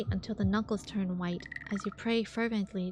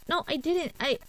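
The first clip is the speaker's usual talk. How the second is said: defensive denial; 7 semitones higher, 4 dB louder than usual. Acted. Accused of cheating, denies.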